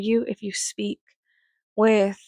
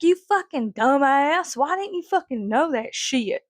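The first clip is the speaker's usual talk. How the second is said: mock irate grandpa, pitched up; angry old man; 5 semitones higher than usual. Old man berating someone for not knowing, angry imitation. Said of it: "very angry old man archetype".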